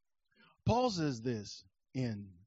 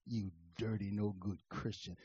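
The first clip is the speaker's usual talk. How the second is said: low, soft, menacing devil; a hint of a sly accusing devil; sneering devil; 9 semitones lower, 8 dB quieter than usual. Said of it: "the devil says".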